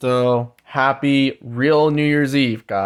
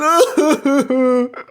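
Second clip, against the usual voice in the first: high giggle; laughing; 11 semitones higher than usual. Real, not acted. Vlogger laughing at his crybaby impression, giggling. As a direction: crack up at the joke.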